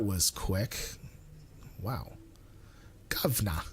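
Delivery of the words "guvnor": low, lightly thick Cockney; Cockney accent. Pretend. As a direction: drop it like a Cockney. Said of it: "really thick".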